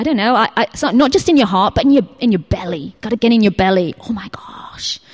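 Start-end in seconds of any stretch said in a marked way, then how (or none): 4.1-5.0 "Oh my gosh" whispered in awe; awed whisper. Retold or imitated. To the congregation, awed whisper. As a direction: breathe it out, stunned. Awestruck at God's size.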